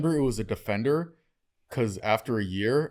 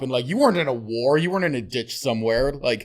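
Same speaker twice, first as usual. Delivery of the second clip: usual speaking voice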